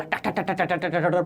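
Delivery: soft, rapid tak-tak-tak; imitated ticking. Shown as a demonstration. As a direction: patter out quiet ticks for water moving along. Mimics water moving down the pipe. (धीमी आवाज़ में टक-टक की नकल)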